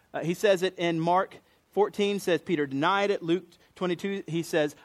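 usual speaking voice

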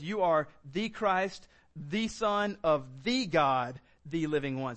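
slow, each "the" leaned on firmly; stress on the. Shown as a demonstration. Preacher stressing the Greek articles in Peter's confession, slow and deliberate. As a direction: give every "the" its own weight, slowly. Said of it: "there's four definite articles".

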